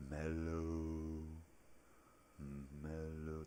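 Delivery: drawn-out, soothing stoner chant; Chong. Acted. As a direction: hold the word long and level. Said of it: "And Chong is like".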